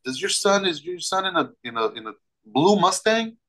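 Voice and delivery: high, urgent retold yell at the father. Yelling at the father on the phone, high and urgent. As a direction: pitch jumps high, pressing him hard. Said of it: "And I yell even louder".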